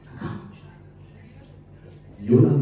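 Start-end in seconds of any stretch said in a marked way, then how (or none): 0.1-0.7 breathy whispered aside; hushed fearful whisper. Shown as a demonstration. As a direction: drop to a whisper to show fear.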